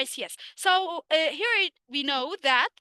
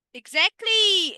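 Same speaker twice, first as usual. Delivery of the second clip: emphatic praise; 5 semitones higher, 8 dB louder, slower than usual. Real, not acted. Teacher praising right answer.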